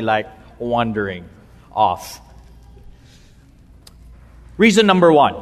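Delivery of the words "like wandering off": low, soft, fading away; drifting off. Acted. Preacher teases listeners whose minds are wandering, drifting off. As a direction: let the voice sink and trail off.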